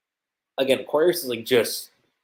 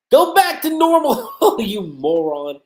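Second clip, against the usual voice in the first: bossy Aquarius; 9 semitones higher, 9 dB louder than usual. Retold or imitated. Aquarius ordering Lucy.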